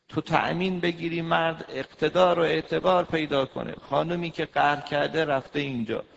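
loud, high fiery sermon voice; preaching. Acted. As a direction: hammer the point home to the women. Preaching at full force.

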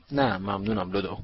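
plain reading voice

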